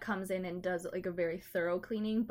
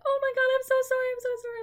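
much higher and louder, flustered; gushing apology from an embarrassed owner; frantic apologizing owner; 14 semitones higher, 7 dB louder, faster than usual. Retold or imitated. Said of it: "was like".

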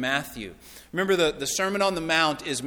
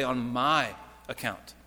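slow, lower, with MY stretched out; stress on MY; 4 semitones lower, 4 dB quieter, slower than usual. Shown as a demonstration. Preacher correcting to what Jesus would say, stress on MY. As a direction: lean long on "my", then drop "account". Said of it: "He would say".

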